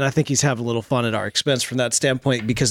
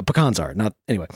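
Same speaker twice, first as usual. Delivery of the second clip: usual speaking voice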